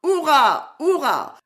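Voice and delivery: high, loud cheers; ringing, excited hurrahs, each falling; triumphant shout. A narrator's reading. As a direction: whoop it out in triumph. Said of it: "en criant".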